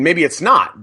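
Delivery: usual speaking voice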